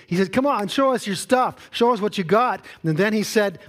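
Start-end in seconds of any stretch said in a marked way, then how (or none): 0.3-2.6 mocking challenge, much higher and louder; taunting. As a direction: taunt the idols, daring them. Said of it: "taunted the idols of the day".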